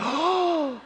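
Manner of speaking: gasped high "oh" of wonder; retold amazed gasp. Dad retelling son reaching for the ball, amazed gasp. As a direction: act out a delighted gasp. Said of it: "And I was like".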